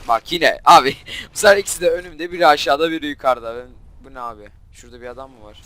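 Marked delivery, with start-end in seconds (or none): 0.1-3.5 loud, laughing-mad protest; exasperated outburst. Real, not acted. Gamer protesting two enemies right in front, exasperated. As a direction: complain at full volume, rising then easing.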